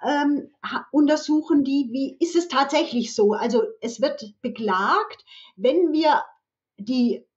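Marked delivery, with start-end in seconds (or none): none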